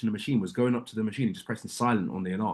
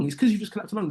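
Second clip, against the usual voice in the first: raised, high insistent comeback; exasperated accusation; heated retort; 10 semitones higher, faster than usual. Real, not acted. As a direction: push back hard at them. Arguing with staff.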